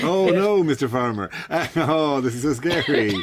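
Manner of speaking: high-pitched mock alarm with a laugh in it; hammed-up, badly faked terror; feigned fright. Crow pretending to the farmer to fear scarecrows, mock scared. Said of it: "not letting on that they're scared by scarecrows".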